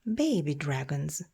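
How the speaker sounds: usual speaking voice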